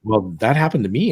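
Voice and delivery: normal talking voice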